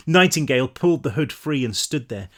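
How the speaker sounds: usual speaking voice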